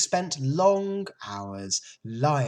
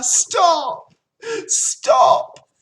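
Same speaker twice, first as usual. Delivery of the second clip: high, very loud piglet shriek; panicked scream; 13 dB louder than usual. A narrator's reading. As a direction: scream to cut the sheep off. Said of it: "screamed Wilbur".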